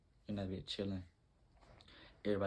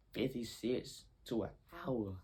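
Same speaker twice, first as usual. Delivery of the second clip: mocking the ad; 3 semitones higher, slower than usual. Reading the price off the ad to his friend, mockingly. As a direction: sneer at the price like it's a joke.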